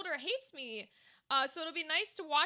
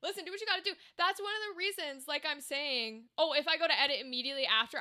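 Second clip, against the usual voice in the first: ordinary talk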